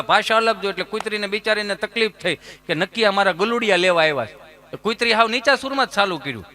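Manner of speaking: ordinary talk, nothing marked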